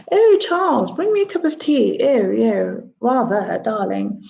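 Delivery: lightly voiced posh English Queen accent; a hint of the Queen's posh English accent. Child performing the Queen for guests, light posh English accent. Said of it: "pretend to be the Queen".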